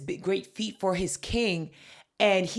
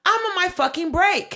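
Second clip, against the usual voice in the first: higher and louder, snapping back; fed-up clerk; 11 semitones higher, 7 dB louder than usual. Retold or imitated. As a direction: fire it back at them. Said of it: "she literally goes".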